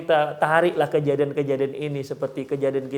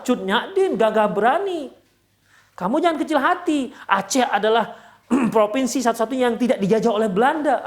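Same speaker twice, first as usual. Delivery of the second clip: higher-pitched, proud pep talk; acted encouragement; 6 semitones higher than usual. Husband cheering up his upset wife, encouraging. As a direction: talk them up, pitch raised throughout. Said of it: "cara untuk membesarkan hati sang".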